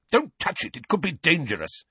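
high-pitched urgent warning; alarmed roar. Acted. Uncle warning his wife about a dangerous letter, roaring in alarm. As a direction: warn her off in a panic. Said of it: "roared Uncle Vernon".

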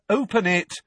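put-on high, insistent boy; urging. Nephew pushing his aunt to open the letter, urging. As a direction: press her to act at once. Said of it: "Harry urged her".

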